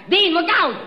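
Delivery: loud, high warning cry; alarmed shout. Acted. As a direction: yell to save your friend from the cab. Warning shout at oncoming cab.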